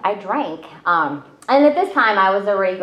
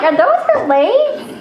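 much higher, louder and slow, laughing through it; laughing dad impression; 13 semitones higher, 6 dB louder than usual. Daughter laughing as she retells her dad finding her, high laughing mock voice. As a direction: lift the voice and laugh while acting out the dad. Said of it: "he's like".

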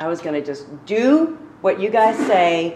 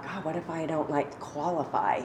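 murmured worry, much softer; quiet inner thought; 8 dB quieter than usual. Retold or imitated. To herself, dreading intake, a soft worried murmur. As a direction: hush it like a private fearful thought. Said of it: "like, I thought".

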